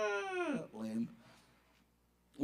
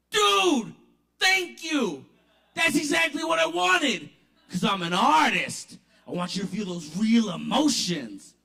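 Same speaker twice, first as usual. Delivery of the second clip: excited thanks; 5 semitones higher, 8 dB louder than usual. Retold or imitated. Rapper thrilled with reaction.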